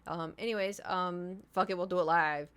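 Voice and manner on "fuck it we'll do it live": ordinary talk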